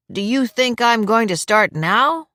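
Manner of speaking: slow, playfully stern demand; mock-stern grandpa; mock severity. A narrator's reading. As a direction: demand it with pretend sternness, pitch climbing. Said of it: "demanded with mock severity in his voice".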